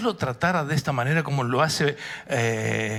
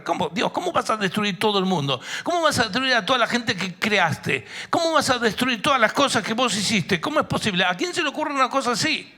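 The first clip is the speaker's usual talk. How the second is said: high, indignant protest, raised but not screamed; mock outcry; 7 semitones higher than usual. Preacher imagining Noah crying out at God's plan, indignant. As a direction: fire appalled questions upward at God. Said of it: "Le empieza a gritar".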